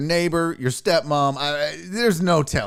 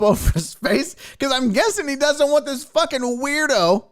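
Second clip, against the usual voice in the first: ordinary delivery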